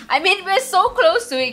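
high loud eager push; almost there, thrilled; excited. Real, not acted. Player almost earning the hat, loud and eager. Near reward.

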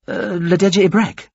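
high-pitched, sudden answer; almost blurted. A narrator's reading. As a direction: blurt out the name after a brief hesitation. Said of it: "almost blurted".

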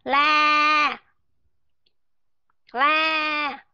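"La" held long, high and open, no closing consonant; open-mouthed ending. Shown as a demonstration. Teacher showing class the mouth left open, long open ending. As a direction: sustain "La" without letting the lips close. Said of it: "Mở nó sẽ là như vậy nè".

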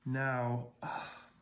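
ordinary delivery

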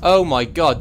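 shocked exclamation. Real, not acted. Overwhelmed by many enemies.

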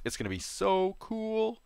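excited. Real, not acted. Excited about showing fossils.

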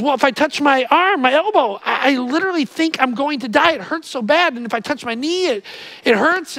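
mock-panicked hypochondriac; anxious patient.

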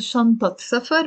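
شنطة ending in -at before سفر; a vowel before the linking T; fatha before the T. Shown as a demonstration. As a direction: say shantat safar. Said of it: "بدل ما نقول".

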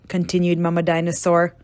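ordinary delivery

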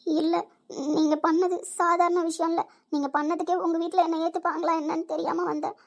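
moved to tears, voice lifted high; crying. Acted. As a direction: talk through tears, overwhelmed by their kindness. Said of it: "அழாதம்மா".

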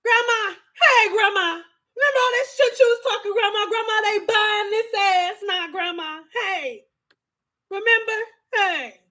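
high, teasing singsong calls to Grandma; gloating taunt. Retold or imitated. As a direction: rub it in her face, high and gleeful. Taunting grandma in retold scene.

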